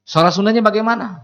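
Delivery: high, searching question; God questioning. Acted. God asking the angel about the man, high and questioning. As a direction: ask the angel sharply, pitched high. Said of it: "Kata Allah kepada malaikat".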